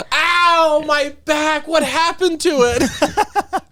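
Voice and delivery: high, hammy fake pain; overacted injured wife, slow; bad acting. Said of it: "She's trying to, like".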